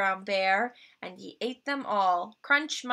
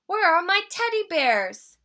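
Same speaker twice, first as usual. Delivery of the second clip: high, eager cub voice; sweet little bear cub; 9 semitones higher, 8 dB louder than usual. Acted. Little Brown Bear's voice.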